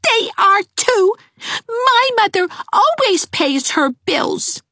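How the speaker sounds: put-on high child voice, drawn out and near tears; a little girl chanting back, tearful; high whiny child; childish singsong. Twins quarrel, child voice.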